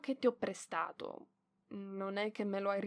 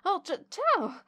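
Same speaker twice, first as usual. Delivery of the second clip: higher, slow, caught-out stammered hello; startled greeting; 9 semitones higher, 5 dB louder, slower than usual. Acted. Librarian bumping into the boy she was looking for, startled. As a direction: trip over the greeting as he appears. Suddenly meets him again.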